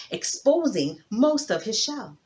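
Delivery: ordinary delivery, nothing marked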